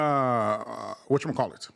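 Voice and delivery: ordinary talk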